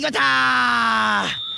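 drawn-out goal shout; excited goal call. Real, not acted. Second goal in live commentary. (興奮したゴール実況)

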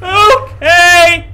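high, loud shriek of alarm; startled scream. Real, not acted. Player jolted by the horror game, startled scream. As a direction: scream it out in fright.

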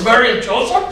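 high, amazed Irish lilt; hammed-up excited Irish brogue; Irish accent. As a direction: play an Irishman thrilled at the coincidence. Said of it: "a little bit of an Irish accent".

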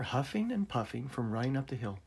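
usual speaking voice

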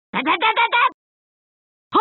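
high rat-a-tat battle shout; rapid attack cries. Acted. Fighter raining blows, high rapid-fire cries.

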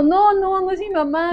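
put-on high, pleading child's cry; desperate child. Lost girl answering the helper, high and desperate. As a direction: plead like a frightened little girl. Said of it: "gritando desesperada".

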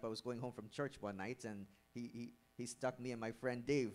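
ordinary talk, nothing marked

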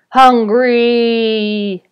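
loud, drawn-out monster cry; great spider's menacing roar; blood-curdling bellow. A narrator's reading. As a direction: bellow like a giant hungry spider. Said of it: "bellowed the great spider in a blood-curdling voice".

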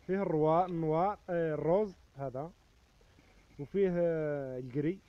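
loud market call listing the colours; loud hawking. Acted. Vendor calling the available colours to shoppers, loud. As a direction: project it over the crowd.

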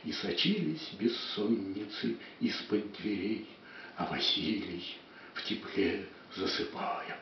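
builds up loud, fades to a hush; dramatic poetry declamation. Acted. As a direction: swell the verse, then sink to whispering. Poem declaimed, fading.

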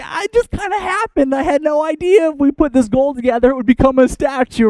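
high-pitched mock innocence; comically squeaky plea of not knowing; defensive excuse. Aaron excusing the golden statue to Moses, high defensive excuse.

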